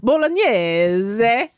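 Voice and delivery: "Bolognese" drawn out slowly in an Italian accent. Shown as a demonstration. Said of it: "Can you tell?".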